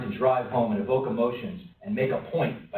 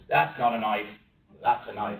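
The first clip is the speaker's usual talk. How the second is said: lower-pitched Australian film-hero quote; Australian accent; 3 semitones lower than usual. Acted. Dundee answering a mugger who has a knife, Australian accent. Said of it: "That was an Australian accent".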